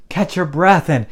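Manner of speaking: ordinary talk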